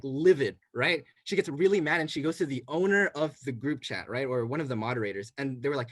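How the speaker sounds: ordinary delivery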